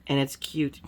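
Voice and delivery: usual speaking voice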